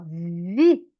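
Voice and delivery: vi said low and separate; syllable demo. Shown as a demonstration. Teacher shows the syllable vi leaving the mouth. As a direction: pop out vi as one syllable. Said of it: "Quando sai a sílaba da minha boca".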